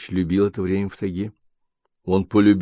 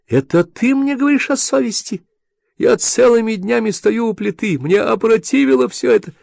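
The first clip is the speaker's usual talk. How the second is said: much higher, furious woman's voice; shrill wife; 13 semitones higher, 3 dB louder than usual. Acted. Wife berating her husband, high and furious. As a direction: rail at him in a high woman's voice.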